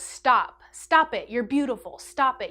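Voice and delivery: firm brother's interjection. Retold or imitated.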